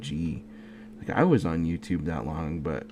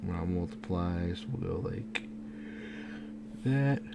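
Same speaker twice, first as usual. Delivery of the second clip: talking through a yawn; words stretched by a yawn; 4 dB quieter, slower than usual. Real, not acted. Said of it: "sorry about the yawning".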